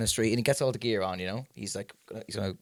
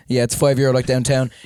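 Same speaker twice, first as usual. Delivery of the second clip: loud laughing punchline as the nun; nun's punchline; 10 dB louder than usual. Retold or imitated. Host delivering the joke's payoff as the nun, loud and laughing. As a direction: belt out the nun's answer through a laugh. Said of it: "And she goes".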